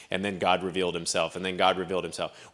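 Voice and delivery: mock bored read-aloud. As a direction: drone it like a bored Bible reader. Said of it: "We get bored when we read the Bible".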